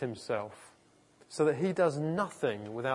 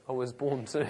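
firmer take breaking into a laugh; like you mean it. Shown as a demonstration. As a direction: say it with some conviction, amused. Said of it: "Say it like you mean it".

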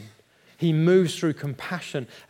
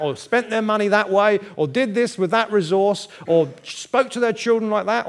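building indignant rant, higher and louder; mock the self-righteous complainer, building; judgmental ranting; 5 semitones higher, 6 dB louder than usual. Preacher imitating a judgmental person, rising indignation. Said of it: "thinking things like".

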